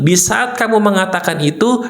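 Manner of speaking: normal talking voice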